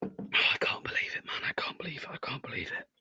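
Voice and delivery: crushed fan whispering to himself; despairing whisper. Real, not acted. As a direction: whisper it as if winded by the result. Lead thrown, slumped disbelief.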